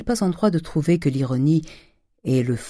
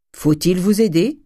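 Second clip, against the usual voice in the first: raised, higher voice calling to someone; called out; 5 semitones higher, 5 dB louder than usual. A narrator's reading. Servant calling to her master at work on the stairs, shouted. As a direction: shout an offer of help across the house. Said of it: "lui cria Nanon".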